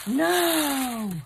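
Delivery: loud, drawn-out astonished cry; loud, amazed exclamation; delighted disbelief. Real, not acted. Disbelief at the gift.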